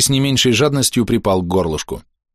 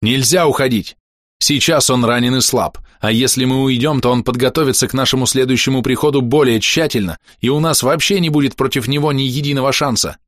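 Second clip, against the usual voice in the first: raised furious insistence; berserk rage; 4 semitones higher than usual. Acted. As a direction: refuse hotly, pushing higher. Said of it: "в ярости вскричал я".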